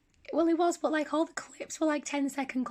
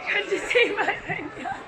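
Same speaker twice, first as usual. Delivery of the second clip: laughing; 9 semitones higher, 6 dB louder than usual. Real, not acted. Laughing at friend.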